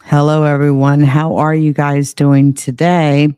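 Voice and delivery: loud greeting. Real, not acted. Streamer loudly greets viewers.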